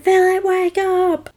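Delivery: high mock call to wake him; mock yell. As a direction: call his name high, half-shouting. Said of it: "they're all yelling at him".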